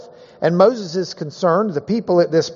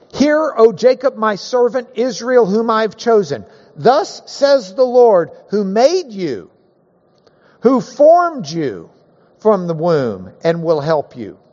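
scripture reading; 4 semitones higher than usual. Acted. Reading Isaiah aloud.

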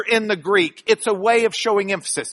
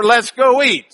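Let's go eat in a quicker Texas twang; Lubbock accent; 3 semitones higher, 6 dB louder than usual. Shown as a demonstration. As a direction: say it like a Lubbock local, go punched. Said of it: "That's Lubbock for".

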